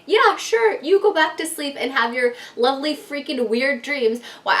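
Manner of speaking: high, loud mock agreement; acted-out scornful go-ahead; sarcastic retort. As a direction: open high and punchy, dripping sarcasm. Retold sarcastic retort to husband.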